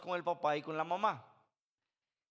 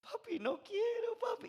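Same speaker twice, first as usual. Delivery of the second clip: put-on much higher, slow, tearful child's plea; crying little girl. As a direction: beg your papi like a scared five-year-old. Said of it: "ella empieza a llorar". (imitando a su hija llorando, voz aguda y lenta)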